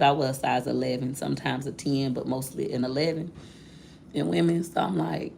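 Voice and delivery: ordinary talk, nothing marked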